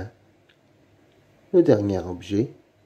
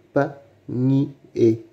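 "panier" slowly split into separate syllables; 4 semitones higher than usual. Shown as a demonstration. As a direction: break "panier" apart with clear pauses. Teacher splits word into syllables. (« panier » lentement, syllabe par syllabe)

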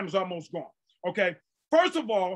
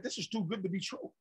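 quiet disbelief; 12 dB quieter than usual. Retold or imitated. Preacher recalling his own doubts while reading, quiet disbelief. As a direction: recall private doubt under the breath. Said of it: "I was like".

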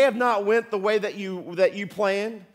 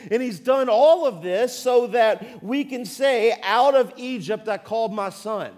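louder, driving sermon delivery; preaching at full force; 2 semitones higher, 4 dB louder than usual. Acted. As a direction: preach it out to the whole room. Preaching to the congregation.

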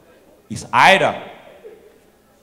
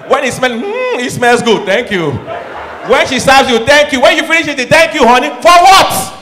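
climbing higher and louder to a shout; building exasperation; 8 semitones higher, 8 dB louder than usual. Acted. Comic list of thanks, building to a shout. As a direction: pile it on until exasperated.

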